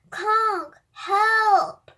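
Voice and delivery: loud high call for help; shouting. A narrator's reading. Boy calling the robot from the flying van, loud shout. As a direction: yell out the window, high and loud. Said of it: "He opens a window and shouts".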